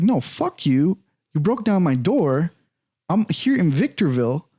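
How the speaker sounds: retold angry protest, pitched high; acting out an outraged comeback; indignant retort. Acted quarrel with the officer.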